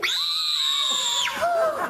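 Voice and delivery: very high piercing scream; loudest scream. Acted. Girls screaming across the island, piercing high scream. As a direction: let out a bone-chilling scream. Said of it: "screamed the loudest scream".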